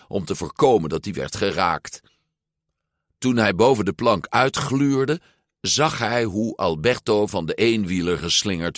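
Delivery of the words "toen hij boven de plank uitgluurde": plain reading voice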